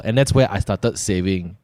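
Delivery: normal talking voice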